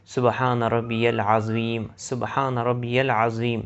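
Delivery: slow, careful سبحان ربی العظیم; slow correct recitation. Shown as a demonstration. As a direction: recite each word of سبحان ربی العظیم slowly and clearly. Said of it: "بہت سے لوگ یہ جلدی جلدی پڑھتے ہیں". (سبحان ربی العظیم آہستہ اور صاف)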